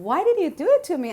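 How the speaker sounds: high, loud, fast accusing demand; angry plea to God. Retold or imitated. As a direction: fire the question up at God in rage. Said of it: "I was so angry at him".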